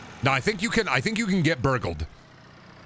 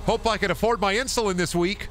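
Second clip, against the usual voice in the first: higher, forced-cheery employee voice; a hammed-up cheery clerk talking to his boss; put-on retail worker; 9 semitones higher than usual. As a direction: chirp it out like a worn-down gas station clerk. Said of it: "Oh, I hate that".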